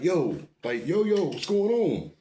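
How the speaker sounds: high, slowed confusion; stunned after the hit; dazed alarm. Retold or imitated. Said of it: "grabbing my ear like".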